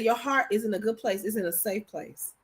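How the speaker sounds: a quiet, low protective promise; husband's soft reply. Retold or imitated. Husband to wife promising to protect her heart, softly and low. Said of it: "He said".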